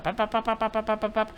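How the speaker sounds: high, monotone rapid popping; nagging noise. Acted. Wife nags for thirty minutes, monotonous nagging noise. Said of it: "the classic wife nag".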